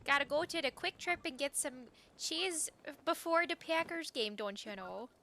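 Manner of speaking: hammed-up Midwestern accent, pitched high. Shown as a demonstration. Streamer showing viewers the local talk, thick Midwestern accent. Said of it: "Here, I'll say it".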